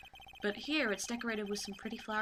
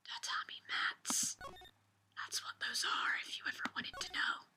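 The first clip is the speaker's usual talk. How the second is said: whisper; 5 dB quieter than usual. Pretend. Player pointing out tatami mats, whispering. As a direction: whisper it like a secret tip. Whispered aside while exploring the room.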